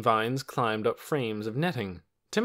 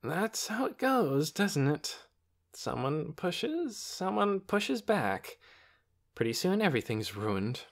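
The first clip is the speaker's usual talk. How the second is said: slow, resigned, drawn-out words; weary; 8 semitones higher, slower than usual. A narrator's reading. The doctor muses on how fights go, weary. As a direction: sigh it out slowly, resigned. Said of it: "in a weary voice".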